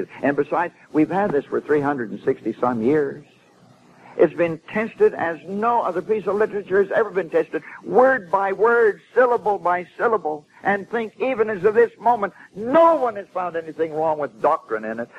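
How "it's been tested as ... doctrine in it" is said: higher, insistent pulpit delivery; emphatic preaching. Real, not acted. Preacher defending the tested scripture to his congregation, insistent. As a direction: hammer out the claim, stretching "word by word".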